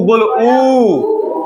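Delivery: ऊ stretched very slowly with rounded lips; rounded u demo. Shown as a demonstration. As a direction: hold ऊ long to show the rounding. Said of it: "होठ गोल हुआ". (होठ गोल करके बहुत धीमा ऊ)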